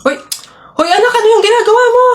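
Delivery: scolding mother. Acted.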